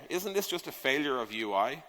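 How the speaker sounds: normal talking voice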